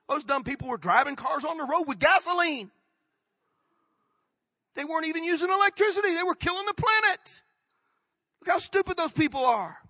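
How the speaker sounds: high, loud incredulous scoffing; hammed-up scornful astonishment; mocking disbelief. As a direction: scoff at those backward fools, pitched high. Voices future people mocking us.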